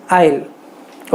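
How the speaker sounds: "aisle" said very slowly, with the s silent; correct pronunciation. Shown as a demonstration. Teacher modelling "aisle" slowly for students. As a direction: stretch out "aisle" and keep the s silent. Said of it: "एस भी silent है".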